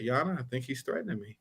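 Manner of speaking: imitating a calm, hushed lawyer. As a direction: report it quietly, completely unruffled. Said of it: "very calmly, he told the judge".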